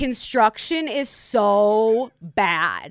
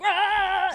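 wind noise; 12 semitones higher than usual. Retold or imitated. Imitating helicopter wind.

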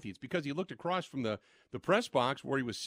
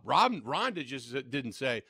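usual speaking voice

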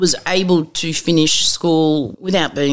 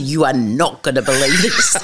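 laughing through the words; can't hold back the laugh; laughing disbelief; 3 semitones lower than usual. Real, not acted. Telling family, laughing.

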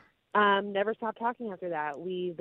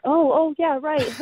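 high, louder flustered realization; mock flustered, pitched up; embarrassed stranger; 8 semitones higher, 6 dB louder than usual. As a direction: imitate someone sheepishly catching on.

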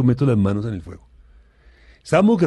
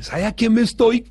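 higher, louder boastful quip; imitating a show-off; 10 semitones higher, 6 dB louder than usual. Man boasting to friends, in a mock bragging voice. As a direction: ham up a bragging guy. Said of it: "como lo tiene que decir".

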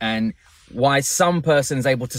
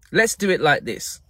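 ordinary talk, nothing marked

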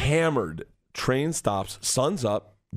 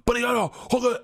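retold yell; 11 semitones higher, slower than usual. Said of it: "starts yelling".

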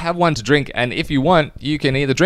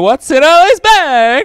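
announcer voice; 9 dB louder, slower than usual. Acted. Announcing segment title.

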